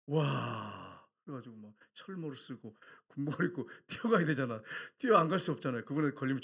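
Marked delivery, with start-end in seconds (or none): none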